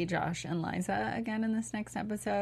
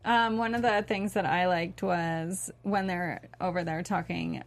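usual speaking voice

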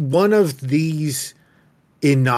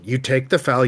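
normal talking voice

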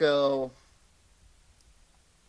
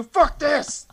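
high, louder fed-up shout; mock outburst; 12 semitones higher, 5 dB louder than usual. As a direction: storm out of the interview. Candidate flips the table at interview.

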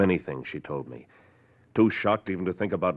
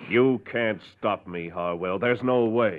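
slow, raised defiance; defiant; 6 semitones higher, 5 dB louder, slower than usual. Acted. Doctor defying the man who threatens him, raised and firm. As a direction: stand your ground loudly. Heated quarrel, doc defies Harwell.

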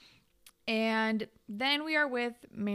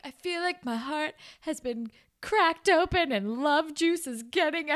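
higher-pitched, lightly acted wail of heartbreak; mock lovesick lament; 6 semitones higher, 4 dB louder than usual. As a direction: play up the heartbreak a little. Retelling a character's lovesick lament.

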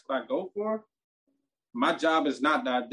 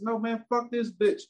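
higher pitched heated retort; retold fury; 7 semitones higher than usual. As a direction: refuse to calm down. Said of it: "That's how fucking mad I was".

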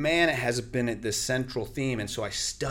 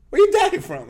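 high, loud probing question; hammed-up baffled coach; quizzing coach; 13 dB louder than usual. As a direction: press the kid for an answer.

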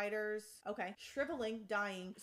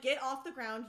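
louder, urgent command; urgent order; 4 semitones higher, 8 dB louder than usual. Acted. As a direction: bark the warning at your rider. Dragon orders rider up mid-battle.

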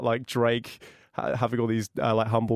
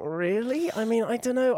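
high-pitched sceptical questioning; sceptical, rising high in pitch; cynical disbelief; 9 semitones higher than usual. Acted. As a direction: question it with open doubt. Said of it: "as a cynical twenty-two-year-old".

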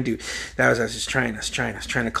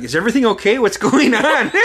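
high, loud mock-frantic mother; shrieky panicked mom breaking into laughter; worried mom; 10 semitones higher, 6 dB louder than usual. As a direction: imitate an alarmed mom, pitched way up. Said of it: "my mom's like".

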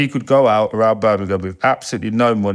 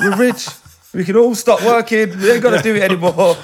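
high, hammed-up elation; overjoyed celebration; 11 semitones higher, 4 dB louder than usual. Son tells mum the family can stop working, overjoyed. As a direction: celebrate like a lottery winner, voice way up. Phoning mum after first paycheck.

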